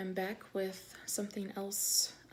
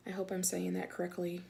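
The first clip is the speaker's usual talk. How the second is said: ordinary delivery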